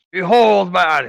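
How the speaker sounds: high, loud grand proclamation; pompous booming ogre mage. Acted. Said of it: "who am I kidding? ... I feel weird".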